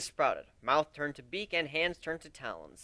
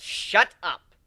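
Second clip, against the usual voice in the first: mock snap; 3 semitones higher, 8 dB louder than usual. A narrator's reading. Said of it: "so maybe I am jealous".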